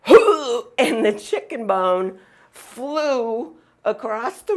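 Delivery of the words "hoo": one high, loud whooping hack; imitated big cough. Owner mimics the dog coughing up the chicken bone loudly. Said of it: "he did one big".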